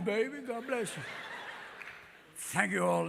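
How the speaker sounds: normal talking voice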